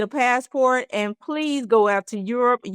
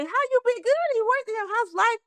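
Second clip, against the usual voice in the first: bubbly catch-up; 13 semitones higher, faster than usual. Retold or imitated. Chatting with old flame.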